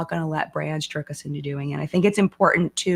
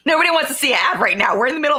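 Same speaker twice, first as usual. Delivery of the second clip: laughing; 7 semitones higher, 5 dB louder, much faster than usual. Real, not acted. Laughing protest at ad idea.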